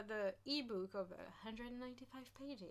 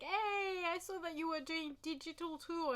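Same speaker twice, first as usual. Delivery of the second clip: retelling own phone call; 6 semitones higher than usual. Retold or imitated. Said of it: "and I was like".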